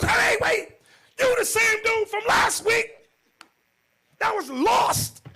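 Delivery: very high, loud accusation; husband recognizing the stranger, shouting; outraged shout. Retold or imitated. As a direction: yell it as it dawns on you.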